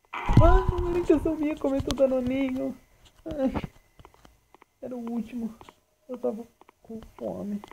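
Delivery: high put-on pleading voice, slowing at the end; silly high pleading voice; mock whiny apology. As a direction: beg the monster like a guilty kid. Apologizing to Samantha monster, put-on voice.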